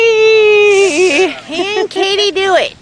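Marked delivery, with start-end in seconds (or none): 0.0-1.4 high, loud cheer; excited whee. Real, not acted. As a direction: cheer her down the slide. Child goes down slide.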